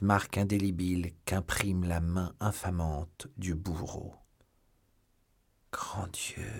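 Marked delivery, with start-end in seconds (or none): 5.7-6.5 breathy, soft cry of shock; stunned, near-whispered exclamation; hushed exclamation. A narrator's reading. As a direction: gasp it out under the breath. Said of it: "s'écria D'Artagnan".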